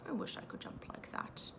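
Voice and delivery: Pooh's wish breathed in a whisper; whispered thought. A narrator's reading. Pooh wishing to himself in a whisper. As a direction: hush it like a private thought. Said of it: "he thought".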